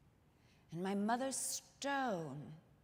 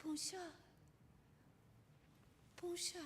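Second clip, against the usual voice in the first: put-on "Bonjour, bonjour" piped higher and softer like small girls; little girls calling back; 9 semitones higher, 12 dB quieter than usual. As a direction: chirp "Bonjour" back like a shy child. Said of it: "And we would call back".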